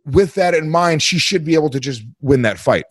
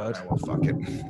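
whispering; 5 semitones lower, 12 dB quieter than usual. Real, not acted. Muttered aside.

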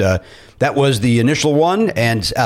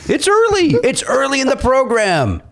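mock exasperation; 11 semitones higher than usual.

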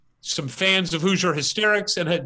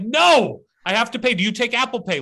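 higher and louder, insisting; insistent protest; 6 semitones higher, 4 dB louder than usual. Retold or imitated. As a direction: refuse firmly but politely. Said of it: "I'm like".

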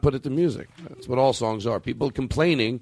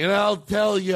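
high-pitched mock drunken rant; complaining uncle; 8 semitones higher, slower than usual. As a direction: imitate the uncle launching into a complaint. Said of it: "hear him complaining".

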